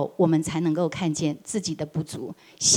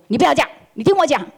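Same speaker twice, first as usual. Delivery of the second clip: higher, louder and fast; angry interruption; 6 semitones higher, 5 dB louder, faster than usual. Retold or imitated. Boss silencing employee's explanation, fast angry snap. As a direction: cut him off sharply.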